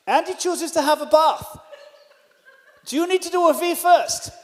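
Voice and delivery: high, loud call in a put-on accent; shouting upstairs. Host calling up about the toilet, shouting upstairs. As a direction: holler the question up the stairs. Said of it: "shout up to Juliana".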